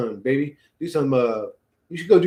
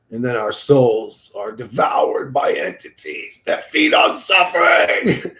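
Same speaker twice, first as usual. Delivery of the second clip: ominous prophecy; 5 semitones lower than usual. Pretend. Streamer voicing the dark lord's doom speech, low then shouting. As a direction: intone it like a dark oracle. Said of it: "ominous dark prophecy".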